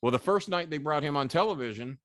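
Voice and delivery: normal talking voice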